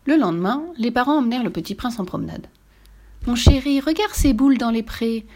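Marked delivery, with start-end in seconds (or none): 3.3-5.3 high, lively mum voice; maman enjouée. A narrator's reading. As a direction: point things out brightly to a small child. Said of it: "dit maman". (voix de maman aiguë et animée)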